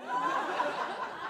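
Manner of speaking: an airy, voiceless chuckle; real laugh after the punchline; breathy laugh. Real, not acted.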